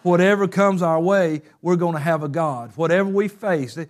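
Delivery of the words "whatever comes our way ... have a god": normal talking voice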